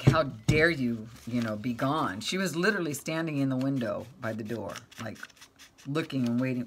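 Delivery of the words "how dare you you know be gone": louder, slow mock-outraged cat; voicing the cat. Retold cat scolding her owner for being gone, mock outrage. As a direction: stretch out the cat's indignation.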